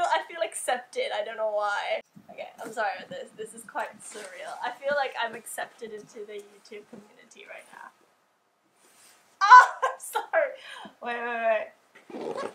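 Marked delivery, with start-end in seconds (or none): 5.3-7.9 breathy awed whisper; stunned little whisper. Real, not acted. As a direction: whisper it, almost to yourself. Overwhelmed aside while unboxing.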